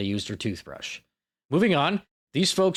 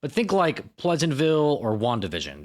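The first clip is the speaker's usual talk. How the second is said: usual speaking voice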